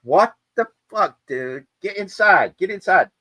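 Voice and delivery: high, loud order to come in; urgent yell. Retold or imitated. Shipmates yelling at the soaked sailor to get inside, loud and high. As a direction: shout him back indoors. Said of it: "shipmates yelling him inside".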